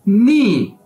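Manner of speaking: नी said clearly as a demo; crisp model नी; Chinese word pronounced. Shown as a demonstration. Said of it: "आपने इसे कैसे बोलना है". (नी को साफ़ और सधा हुआ बोलें)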